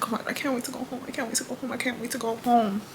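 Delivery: almost whispered, pleading; whispered longing. Retold or imitated. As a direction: murmur it like a tired wish. Longing to go home.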